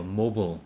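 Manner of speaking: American mobile like 'mobol'; American pronunciation. Shown as a demonstration. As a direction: say mobile with a short dark 'bol'. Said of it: "sounds like M O B O L".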